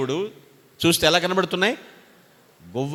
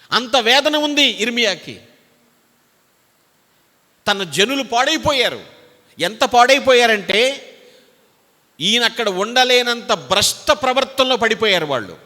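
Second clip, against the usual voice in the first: higher, louder, slow anguished preaching; impassioned preaching; 6 semitones higher, 5 dB louder than usual. Acted. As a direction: press the grief home. (వేదనతో ఎత్తైన గట్టి స్వరం)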